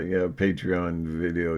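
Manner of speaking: ordinary talk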